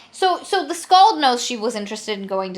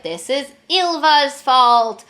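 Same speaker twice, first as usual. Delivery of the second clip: slow, high, loud accusation; mock rage with every word stretched; retold fury; 4 semitones higher, 5 dB louder, slower than usual. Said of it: "Freya gets so mad".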